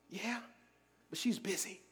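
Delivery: put-on hushed child's whisper; whispering little boy. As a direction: whisper it like a small boy hiding. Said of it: "He was whispering".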